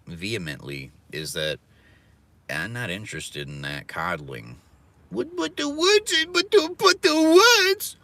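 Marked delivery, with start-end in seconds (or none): 5.1-8.0 very high, slow, whining parody; squeaky mock lament, far higher and slow; mocking whiny voice. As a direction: whine it like an oversensitive crybaby. Mocking an oversensitive listener.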